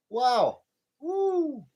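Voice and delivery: disbelieving exclamations; amazed. Real, not acted. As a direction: whoop at the sticker shock. Reacting to price.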